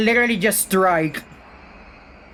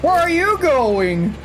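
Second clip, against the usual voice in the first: taunting villain; 8 semitones higher, slower than usual. Acted. Killer taunting fleeing survivor.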